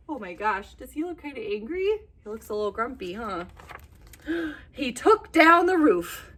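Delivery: ordinary delivery, nothing marked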